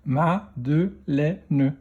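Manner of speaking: slow "Madeleine", split into ma, de, lè, ne; "Madeleine" in four separate beats; syllable by syllable. Shown as a demonstration. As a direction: sound out "Madeleine" with a final ne. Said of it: "dans quelle syllabe".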